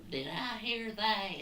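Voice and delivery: high, slow put-on New Jersey neighbor; mock New Jersey accent. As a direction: answer in a squeaky fake New Jersey accent. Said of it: "how people from New Jersey sound".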